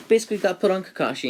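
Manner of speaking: loud, high excited blurt; excited storyteller outburst; sudden loud burst. Real, not acted.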